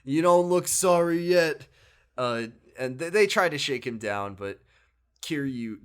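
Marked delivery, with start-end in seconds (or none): 0.0-1.7 loud, slow threat; mock menacing thug. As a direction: lean in and menace. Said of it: "the fake Kiryu is just like".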